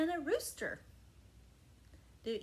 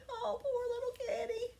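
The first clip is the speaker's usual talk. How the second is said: pitying; 7 semitones higher than usual. Acted. Donkey pities sad cat.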